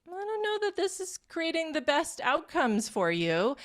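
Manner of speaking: high-pitched, tentative self-talk; lightly acted inner caution; doubting inner voice. Voicing a doubting part of herself.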